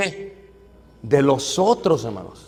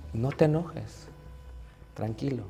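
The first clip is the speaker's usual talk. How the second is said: much softer, low calming voice; soothing; 4 semitones lower, 15 dB quieter, slower than usual. Acted. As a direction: settle him down gently. Meek brother calming him.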